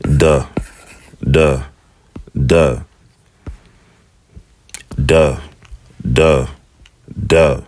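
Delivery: "Duh" with a clean English d; repeated duh, like in dog; d sound drill. Shown as a demonstration. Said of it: "You have a D sound".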